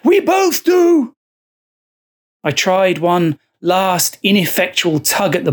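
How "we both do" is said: high, slow, shouted shriek; much higher, drawn-out frantic cry. Acted. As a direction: shriek it in mad faith. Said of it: "Joseph shrieked".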